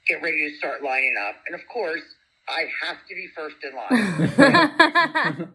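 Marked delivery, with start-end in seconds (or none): 3.6-5.5 laughing. Real, not acted. Laughing at her own fandom.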